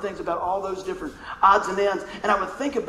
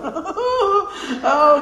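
laugh; 9 semitones higher, 4 dB louder than usual. Real, not acted. Laughing at the memory.

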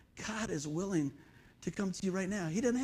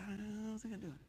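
very soft small voice; quiet timid mock kid; doubtful kid; 15 dB quieter than usual. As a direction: say it like an unsure child. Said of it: "I said".

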